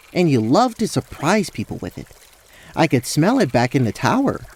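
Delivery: put-on eager, affectionate young suitor; earnest Rael, fond. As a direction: praise an old sweetheart with lively sincerity. Voices Rael in story.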